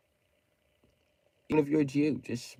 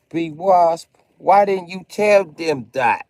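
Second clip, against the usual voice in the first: louder and higher, yelling at the chat; loud shout; 8 semitones higher, 15 dB louder, slower than usual. Real, not acted. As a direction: call him out, full yell. Shouting at viewer about Kyle.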